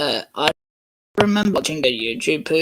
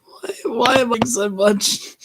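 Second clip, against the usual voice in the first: high, wailing mock sob; mock crying; 11 semitones higher, 7 dB louder than usual. Missus Kindly wailing over her news, mock crying.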